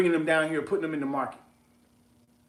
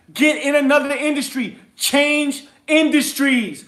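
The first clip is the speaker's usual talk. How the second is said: loud and higher, pushing hard at the crowd; heated yelling rant; 5 semitones higher, 3 dB louder than usual. Real, not acted. Host yelling at his listeners to quit retail. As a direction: preach it at full force, fed up. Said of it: "I ain't trying to yell at y'all".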